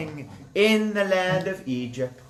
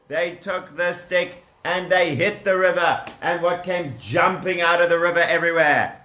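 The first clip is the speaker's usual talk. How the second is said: plain reading voice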